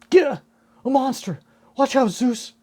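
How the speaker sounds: high, alarmed cartoon yelp; panicked warning. Warning Zeus about the monster, panicked. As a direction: cry out a frantic warning.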